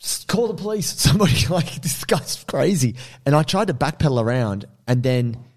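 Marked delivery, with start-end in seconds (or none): 0.0-3.0 urgent appeal. Retold or imitated. Acted appeal for help to onlookers.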